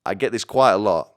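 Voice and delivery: ordinary talk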